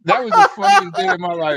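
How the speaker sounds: high, loud, thrilled; excited retelling. Retold or imitated. Telling friends about his fake death, thrilled and loud. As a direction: whoop it like the best day ever. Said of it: "I'm like".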